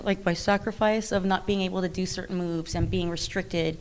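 usual speaking voice

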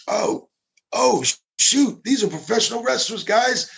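high, excited starstruck squeal; starstruck agents. Retold or imitated. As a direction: gush like a fan spotting wrestlers. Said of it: "they're like".

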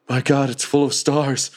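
high, wonderstruck acted exclamation; dying goblin's awe. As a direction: marvel aloud as a doomed creature. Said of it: "he's like".

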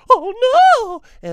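very high, loud oh no; cartoon cry. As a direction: shriek it like a cartoon. Cartoon gag line.

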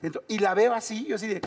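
normal talking voice

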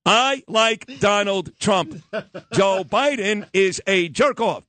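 slow, deliberate reading of a joke opening; hammed-up audiobook narrator; mock audiobook read. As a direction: pretend to narrate your own book, slowly. Mock audiobook reading after "Chapter one.

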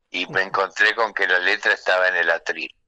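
usual speaking voice

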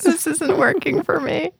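slow, very high little voice; retold helpless high-pitched whine; starstruck squeak. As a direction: squeak it out like a flustered fan. Flustered fan retells meeting her idol.

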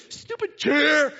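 loud, high mock-furious yell; angry outburst. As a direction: bellow at the chair you just stubbed your toe on. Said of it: "we get angry".

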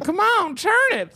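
high, coaxing old man impression; creepy old man. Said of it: "He's like".

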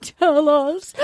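slow, weepy begging; tearful mock pleading. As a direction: plead like an overwrought mom. Voicing weeping mom begging son.

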